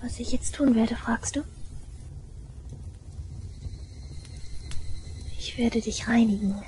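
hushed whisper close and soft; menacing whisper. Acted. Girl whispers to listener that she will cleanse him. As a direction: whisper the threat sweetly.

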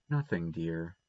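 soft, low, soothing reply; gentle reassurance. A narrator's reading. Anne calming the frightened boy, gently reassuring. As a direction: soothe the child softly, pitched low.

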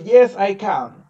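slow, loud Yes, I can; teacher models the answer slowly. Shown as a demonstration. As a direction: spell it out slowly for the child.

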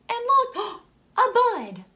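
high and loud with a gasp; excited discovery. A narrator's reading. Reading to kids, gasps with delight at the bud.

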